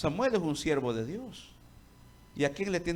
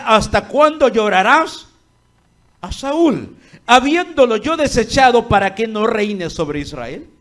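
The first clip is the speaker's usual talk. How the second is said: God's voice, loud; 6 semitones higher, 13 dB louder than usual. Acted. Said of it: "Y Dios dice".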